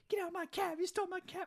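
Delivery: very high, mock shriek after the cab; hammed-up squeal of outrage; shrieking at a cab that drives off. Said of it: "He's like".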